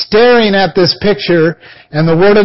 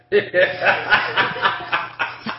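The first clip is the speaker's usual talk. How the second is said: breathy mock sound effect, no voice; a quick voiceless noise for the limo window; 9 dB quieter than usual. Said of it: "Sound effects".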